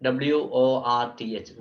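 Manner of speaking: W O R T H spelled letter by letter. Shown as a demonstration. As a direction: spell W O R T H out for the class. (W O R T H അക്ഷരം തോറും)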